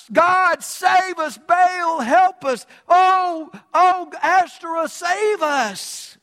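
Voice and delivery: high, loud plea to the heavens; desperate crying out. Acted. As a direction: wail it out like sailors begging their gods. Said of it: "are on their knees crying out".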